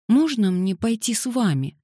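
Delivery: put-on high, sweet child's question; little-girl plea. A narrator's reading. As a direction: ask in a small high pleading voice. Little girl begs to come along.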